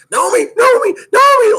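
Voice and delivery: high, loud sing-song calling out of a name. Retold or imitated. Official calling a skater off the track, high loud call. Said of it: "like that".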